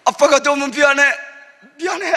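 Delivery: high, loud pleading apology; anguished apology. Retold or imitated. Father cries out an apology to his weeping son. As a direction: cry out sorry to your son.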